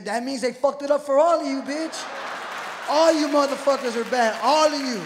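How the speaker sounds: loud, rising accusing yell; ranting shout. Acted. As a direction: blast everyone at once. Acted passenger ranting at everyone.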